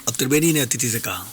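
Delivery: usual speaking voice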